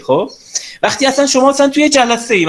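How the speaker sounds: usual speaking voice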